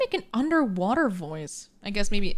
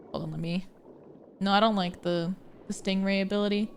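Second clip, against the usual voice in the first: usual speaking voice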